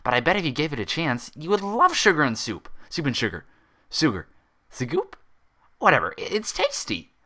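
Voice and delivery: high, bouncy pony voice, playful; giddy high pony voice; bubbly Pinkie Pie. A narrator's reading. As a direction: chirp it like an excitable pony.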